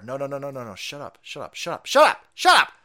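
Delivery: mock exasperation rising to a shout; building mock shushing. Cutting off an imagined objector.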